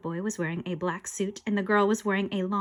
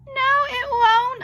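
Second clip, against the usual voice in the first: high, loud, drawn-out wail; defiant protest; 8 dB louder, much slower than usual. Acted. Boy contradicts the ghost, loud defiant protest. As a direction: wail a slow refusal.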